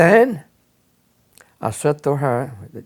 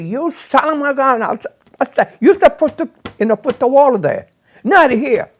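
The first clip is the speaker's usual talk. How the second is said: higher, louder scolding yell; retold yell; 8 semitones higher, 3 dB louder than usual. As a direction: chew out the worker as it happened back then. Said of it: "started screaming to him".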